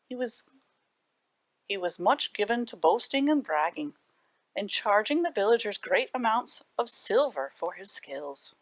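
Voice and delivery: usual speaking voice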